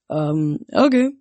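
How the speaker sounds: slow, hesitant "um" rising into okay; stall, then answer lamely; dumbstruck reply. A narrator's reading. Stunned boy answers a girl claiming to be a goddess, dumbstruck. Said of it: "said something really intelligent like".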